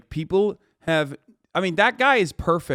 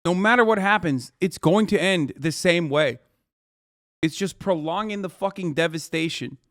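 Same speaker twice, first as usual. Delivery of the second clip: ordinary talk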